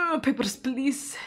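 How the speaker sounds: high gasp into a rattled question; jump, then ask shakily; startled gasp. Real, not acted. Inspector startled by a new entrant, gasping.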